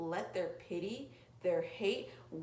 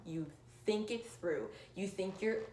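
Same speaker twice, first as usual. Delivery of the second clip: ordinary delivery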